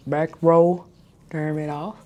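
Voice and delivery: ordinary delivery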